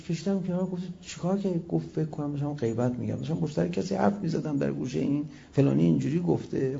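usual speaking voice

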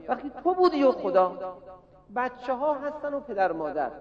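ordinary talk

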